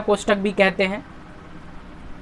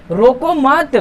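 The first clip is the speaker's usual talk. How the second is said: "रोको मत" as one raised unit; pause after मत; 4 semitones higher, 3 dB louder, much slower than usual. Shown as a demonstration. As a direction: lift मत and stop right after it. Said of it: "उसको क्या कह रहा है".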